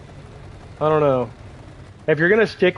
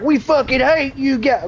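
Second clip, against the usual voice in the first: mocking yokel voice; 9 semitones higher, slower than usual. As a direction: sneer it like an angry inbred local. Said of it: "decided to be like".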